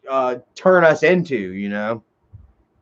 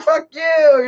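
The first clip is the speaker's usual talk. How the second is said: mock quarrel; 12 semitones higher than usual. Retold drunk shouting match.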